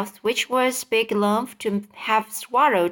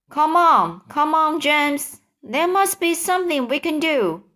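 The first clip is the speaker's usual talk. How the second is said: high, pressing appeal; urging; 6 semitones higher than usual. A narrator's reading. Centipede pressing James for a plan, urging. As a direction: urge him on in a raised pitch.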